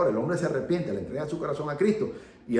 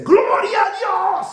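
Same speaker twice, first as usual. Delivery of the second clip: much higher and louder, slow ecstatic cry of praise; joyful shout; 9 dB louder, much slower than usual. Retold or imitated. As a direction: bellow it out as the healed mute's first words. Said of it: "ese hombre pega un grito y dice". (grito de júbilo, muy agudo y fuerte)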